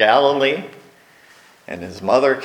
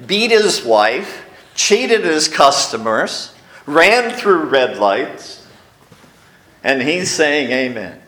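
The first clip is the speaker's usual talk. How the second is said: ordinary delivery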